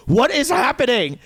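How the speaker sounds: high, drawn-up incredulous cry; exasperated disbelief. Retold or imitated. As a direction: retell it as a baffled outburst. Retelling his bewildered outburst.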